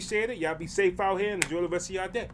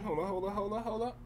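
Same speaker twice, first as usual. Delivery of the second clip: trailing off mumbling; 4 semitones lower, 14 dB quieter than usual. Real, not acted. Fumbling to pause the video.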